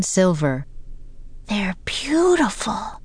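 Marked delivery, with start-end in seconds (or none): 1.5-3.0 high, slow, airy character voice; voiced Annie. A narrator's reading. Annie admires the winged dancers, high airy wonder. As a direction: draw it out high in hushed wonder. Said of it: "said Annie".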